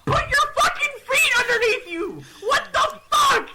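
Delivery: very high, screeching shout; exasperated yelling. Retold or imitated. Brother yelling at dead-weight sister, exasperated. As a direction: scream orders in total exasperation.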